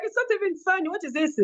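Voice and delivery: high, teary protest; a touch of tears, not finding it funny; upset crying. Retold or imitated. Crying to her aunt that it isn't funny, teary.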